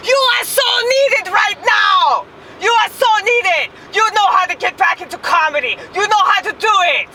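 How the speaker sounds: hammed-up high-pitched cheerleading yell; Ronit impression. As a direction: yell encouragement like a fired-up friend. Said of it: "doing an impression of Ronit".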